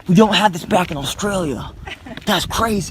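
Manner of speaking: loud, high Aussie wildlife host; amazed, hammed-up Australian explorer; Australian accent. Explorer amazed by what Australia lacks, in a loud Australian accent. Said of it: "Can you speak in an Australian accent".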